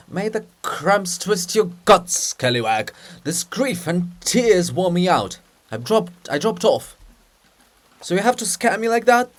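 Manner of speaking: grumbling scold. Acted. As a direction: scold the prankster. Grumpy poet scolding.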